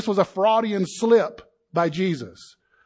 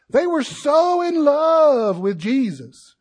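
mock adoration; 7 semitones higher, 5 dB louder, slower than usual. Mocking the crowd's adoration.

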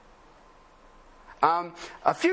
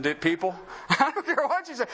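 ordinary delivery

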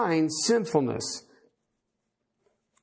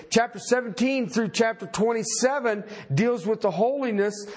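high, ringing preacher's delivery; emphatic preaching; 9 semitones higher than usual. Real, not acted. Preacher explaining holiness as set apart, emphatic high-pitched preaching. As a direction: preach it with rising urgency, stretching the key words.

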